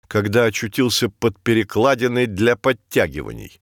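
ordinary delivery, nothing marked

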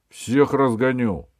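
громкая, протяжная королевская угроза; рявкни на всех сверху; гневный крик. A narrator's reading. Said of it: "закричал он". (гневный окрик короля)

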